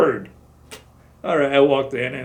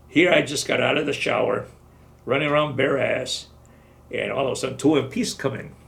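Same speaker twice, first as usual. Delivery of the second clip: ordinary delivery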